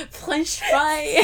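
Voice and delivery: high-pitched, laughing through it. Real, not acted. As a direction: blurt it out while cracking up.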